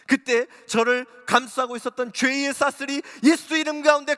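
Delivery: loud, high fervent sermon voice; impassioned revival preaching; full-force preaching. Acted. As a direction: preach it with fire, pushing every line.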